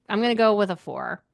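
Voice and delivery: ordinary delivery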